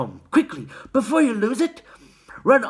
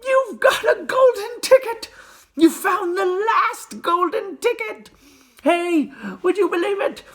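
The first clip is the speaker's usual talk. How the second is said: excited shouting; 5 dB louder than usual. A narrator's reading. Shopkeeper shouting joyfully.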